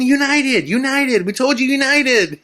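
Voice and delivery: desperate high shout; high frantic yell. Retold or imitated. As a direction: shout the airline name urgently. Yelling at bus driver.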